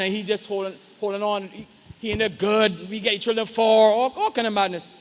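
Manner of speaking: ordinary talk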